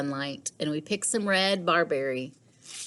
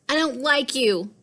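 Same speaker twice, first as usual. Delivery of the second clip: put-on higher, louder hurt girl voice; upset girl; 8 semitones higher, 4 dB louder than usual. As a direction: blurt it out, hurt. Lily upset with Liam.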